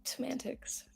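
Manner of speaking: breathy whispered dismissal. Real, not acted. Mock dismissal.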